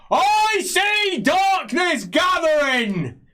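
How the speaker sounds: high, loud, drawn-out doom cry; prophetic shout. Acted. Priest atop a man's shoulders to the crowd, shouting. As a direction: bellow a slow prophecy over the crowd. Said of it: "he shouts".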